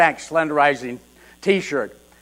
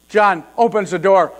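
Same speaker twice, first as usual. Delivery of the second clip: ordinary delivery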